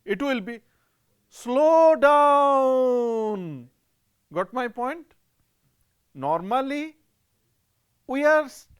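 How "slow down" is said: "Slow down" with long, stretched vowels; lazy drawn-out "Slow down"; drawl. Shown as a demonstration. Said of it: "But if we drawl, it will be".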